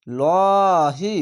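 "লহি" very slow, ল held long and thick; one-alif stretch. Shown as a demonstration. Teacher models the word for learners, slowly stretched. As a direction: recite "লহি", drawing out a heavy ল. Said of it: "খার যাবার এক আলিফ টেনে পড়বেন".

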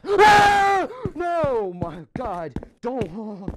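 panicked shriek falling to a gasp; real scream. Real, not acted. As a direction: scream then sputter as it grabs you. Second jump scare.

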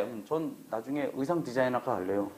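timid student answering the teacher; meek student. Retold or imitated. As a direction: mumble it like a shy pupil.

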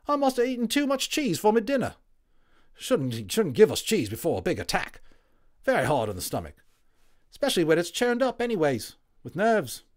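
a WWI orderly waking up chatty; friendly Cockney Tommy, loud and high; cheery Cockney medic. Acted.

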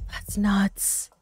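breathy whisper, almost to herself; whispered aside. Real, not acted. Reacting to the trainee years, whispering in disbelief. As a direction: whisper it like a shocked secret.

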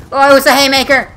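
surprised shout. Real, not acted. Gamer realizing what weapon killed him, loud surprised yell. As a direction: yell it as it dawns on you.

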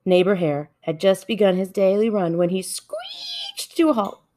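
ordinary delivery, nothing marked

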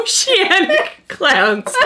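laughing through it; giggling the words out. Real, not acted. Repeating the funny name.

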